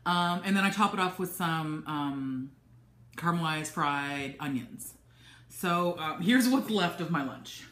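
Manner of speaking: ordinary talk, nothing marked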